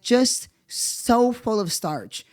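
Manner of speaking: slow, stretched-out sneer; slow scornful drawl; slow mockery. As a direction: drag the words out in scorn.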